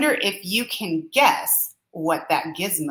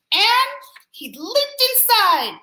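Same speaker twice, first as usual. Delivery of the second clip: ordinary delivery, nothing marked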